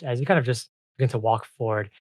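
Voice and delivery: usual speaking voice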